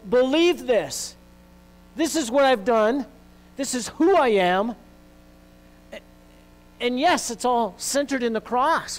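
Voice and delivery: high, loud, ringing preacher's climax; preaching at full force. Acted. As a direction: lift the whole church with this. Climax of the sermon.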